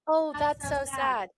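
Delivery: high, slow fake commiseration; sugary imitation of a false friend; mock sympathy. Voices Taylor's two-faced sympathy.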